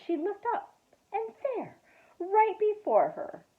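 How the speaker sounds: storyteller's rising amazement; wonder. A narrator's reading. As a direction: gasp in wonder at who is there. Surprise at seeing grandfather.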